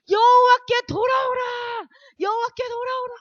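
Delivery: 높이 내지르는 선포의 외침; 광장에서 부르짖듯 높게 길게 외치기; 소리 지르기. Acted. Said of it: "막 소리를 지르는 거예요". (목청껏 부르짖는 외침)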